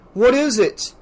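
demanding. A narrator's reading. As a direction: demand an answer. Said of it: "Gerald demanded".

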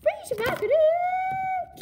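very high, loud, drawn-out cheer; shout-out. Real, not acted. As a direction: scream the name, stretching the end. Repeated shout-out in outro.

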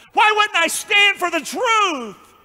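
high, slow preacher's shout; impassioned shout. Real, not acted. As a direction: cry it out in defiance. Preacher defends his tough stance.

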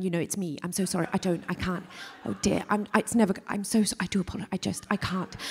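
a fussy, over-apologising Brit, often hurried; the rain tiptoeing in, sorry for everything; apologetic drizzle. Acted. Comic stand-up plays the British drizzle, endlessly apologising.

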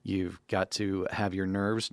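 ordinary talk, nothing marked

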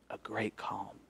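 quiet awe; whispered narration. A narrator's reading. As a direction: hush as the storm stops. Hushed storytelling of calm.

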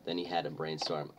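usual speaking voice

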